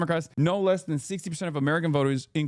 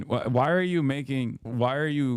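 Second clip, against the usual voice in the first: ordinary talk, nothing marked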